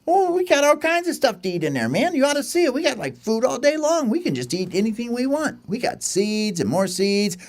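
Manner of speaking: high bragging chick voice; boasting little bird. Acted. Pet bird boasts about food.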